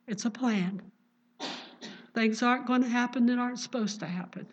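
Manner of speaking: ordinary talk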